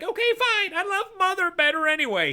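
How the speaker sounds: put-on young boy. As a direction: play a small boy pleading with his dad. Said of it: "Ben's like".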